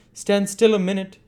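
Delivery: high, loud call. A narrator's reading. Man at a window calling to Bumble, high loud shout. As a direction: shout it down from above. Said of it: "cried the voice".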